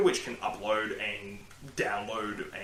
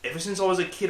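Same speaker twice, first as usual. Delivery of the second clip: usual speaking voice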